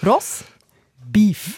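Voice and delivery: "Ross" high, pause, "Beef", said as two words; high "Ross", pause, then "Beef"; split with a gap. Shown as a demonstration. Mocking a hotel menu to her co-host, "Ross" and "Beef" apart. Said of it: "Mit Abstand".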